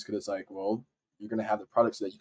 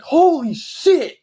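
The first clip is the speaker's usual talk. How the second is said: high, loud, drawn-out shout of disbelief; 10 dB louder, much slower than usual. Retold or imitated. Reading the Nike email, shouting in disbelief. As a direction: let it rip like the best news of your life. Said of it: "I don't think I've ever shouted".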